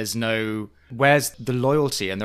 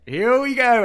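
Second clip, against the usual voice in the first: high, louder gleeful call; gleeful editors; 12 semitones higher, 7 dB louder, slower than usual. Retold or imitated. Co-host voices the editors gleefully starting the montage, high and eager. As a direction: relish what's coming. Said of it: "the editors are just like".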